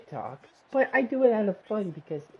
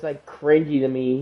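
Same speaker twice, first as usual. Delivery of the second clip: ordinary talk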